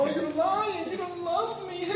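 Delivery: high, soft whiny woman's voice; pouting Delilah. Retold or imitated. Delilah guilt-tripping Samson, high pouting whine. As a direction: sulk like a hurt lover, high and soft. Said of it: "You know".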